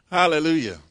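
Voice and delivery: ordinary talk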